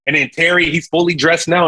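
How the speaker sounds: ordinary delivery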